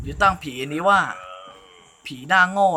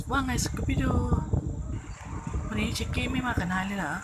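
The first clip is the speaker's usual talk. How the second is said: higher, softer cartoon-character dub; lightly voiced cartoon sidekick chatting with Scooby-Doo; 6 semitones higher, 7 dB quieter than usual. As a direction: voice the cartoon pals a bit higher. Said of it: "เดี๋ยวเราพากย์ ดีๆก่อนนะ".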